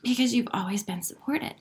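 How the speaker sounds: softly whispered reassurance. Real, not acted. Telling her viewers they are supported, whispered. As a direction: breathe it out, no voice.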